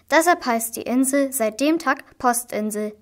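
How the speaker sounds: plain reading voice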